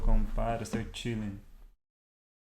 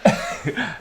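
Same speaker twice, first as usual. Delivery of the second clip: loud high chuckle; amused laugh; 8 semitones higher, 9 dB louder than usual. Real, not acted. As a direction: laugh at the shared scare. Laughing at viewer also scared.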